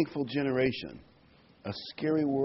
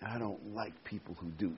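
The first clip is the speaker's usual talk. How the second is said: imagined disdain; 5 semitones lower, 12 dB quieter than usual. Retold or imitated. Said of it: "sense an ooze coming out of her".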